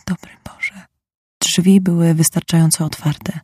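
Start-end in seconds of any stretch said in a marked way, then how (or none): 0.1-0.8 breathless shocked whisper; gasped whisper. A narrator's reading. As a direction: barely breathe the words out in horror. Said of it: "Słyszałam sapnięcie, kiedy mówił".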